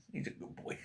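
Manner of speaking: hushed whisper, almost no voice; whispered to the dog. Real, not acted. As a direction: breathe it softly to the dog. Praising the dog nearby.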